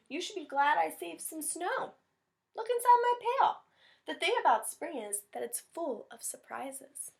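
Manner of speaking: smug Rabbit. Acted. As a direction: show off the surprise. Rabbit reveals saved snow.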